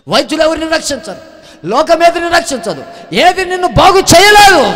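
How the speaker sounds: high, loud, slowing on the last line; fiery preaching climax. Acted. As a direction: cry it out to the crowd. Preaching at full force. (ఎత్తైన స్వరంతో గట్టిగా బోధించడం)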